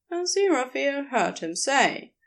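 ordinary delivery, nothing marked

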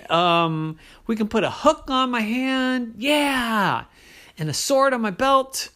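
high, eager little leprechaun voice. Acted. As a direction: bounce with excitement like a small boy. Liam character voice.

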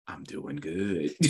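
low, soft, drawn-out reply; unconvincing okay. Retold or imitated. Answering a worried teammate's message, unconvincingly fine. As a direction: drag it out quietly, barely convincing. Acted reply while hiding feelings.

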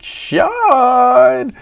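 a long, very high drawn-out shout of a name; hammed-up drunken call across the room. Drunk friend calls from the counter.

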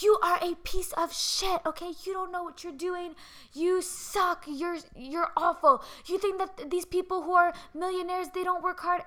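high-pitched mock tirade; imitated yelling motivator. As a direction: berate the listener like a motivational speaker. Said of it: "they'll literally be yelling at you".

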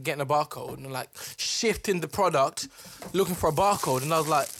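ordinary talk

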